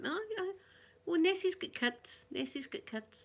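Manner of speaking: teasing the tourists, mock-earnest and high; retelling her own tease. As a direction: sell the fib with a straight face. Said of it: "And I'm going".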